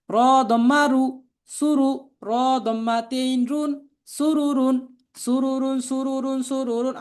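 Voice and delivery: spelling drill recitation. Shown as a demonstration. Teacher spelling out Arabic words for learners to repeat, chanted drill.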